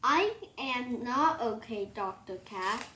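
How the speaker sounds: plain reading voice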